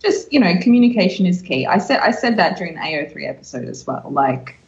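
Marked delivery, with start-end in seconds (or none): none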